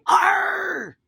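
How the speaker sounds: loud, high pirate cry; pirate "Arr!". Shown as a demonstration. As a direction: show the kids how to shout like a pirate. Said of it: "You gotta say".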